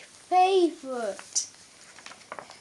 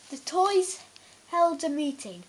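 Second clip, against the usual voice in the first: ordinary delivery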